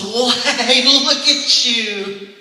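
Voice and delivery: high, slow, sugary greeting; cheery put-on voice. New deacon gushing at a lady in a sugary voice. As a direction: gush over her like a sweet church greeter. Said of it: "the next voice that came out of my mouth".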